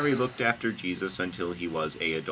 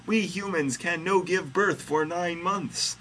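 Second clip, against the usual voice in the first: high, slow character voice; Mary protesting; 10 semitones higher than usual. A narrator's reading. Host reading Mary objecting to God, high-voiced. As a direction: explain it in a high pitch, drawn out. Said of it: "say Mary".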